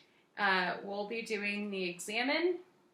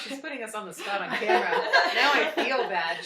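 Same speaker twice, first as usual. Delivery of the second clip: laughing banter; 3 semitones higher, 8 dB louder than usual. Real, not acted. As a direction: give in jokingly through laughter. Teasing after put on the spot.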